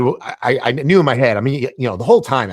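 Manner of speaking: usual speaking voice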